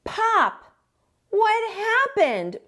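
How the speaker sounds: put-on high, slow child voice crying out; a small boy calling to his dad in dismay; child's cry. A narrator's reading. Junior crying out to his father, high and slow. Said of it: "cried Junior".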